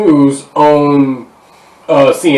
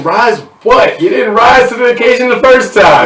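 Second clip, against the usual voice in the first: mocking Southerners who say the South will rise again; mocking retort; 8 semitones higher than usual.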